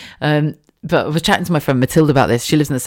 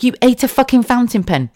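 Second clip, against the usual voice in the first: higher, stunned, stress on fucking; stronger disbelief; 8 semitones higher than usual. Retold or imitated. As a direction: blurt it out, utterly stunned. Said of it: "It's like".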